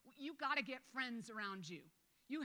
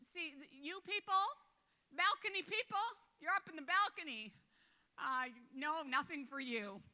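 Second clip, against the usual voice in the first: higher, louder, dismissive mock voice; mock snobbish God; 5 semitones higher, 5 dB louder than usual. Pastor mocking a God who plays favourites, higher and snooty. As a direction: wave off the balcony people, hammed-up. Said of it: "he didn't get up in the morning and go".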